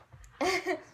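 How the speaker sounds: an embarrassed quick laugh; short giggle. Real, not acted. Reacting to the taste.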